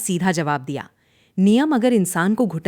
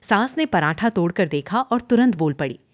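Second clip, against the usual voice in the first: ordinary delivery, nothing marked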